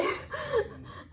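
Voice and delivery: high, breathy laugh through tears; teary laugh. Real, not acted. Host laughing through tears while saying goodbye. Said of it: "like I'm gonna cry".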